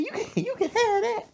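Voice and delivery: high-pitched bossy mother; mama voice. Retold or imitated. Mother taking then refusing gum, high mock voice. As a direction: put on a high, dismissive mama. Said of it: "She said".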